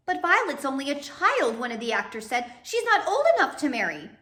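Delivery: high, loud outraged protest; protesting actor. Acted. An actor protesting to Olaf, high and outraged. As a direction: cry out against it.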